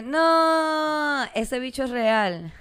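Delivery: mock gushing fan. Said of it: "Es como que".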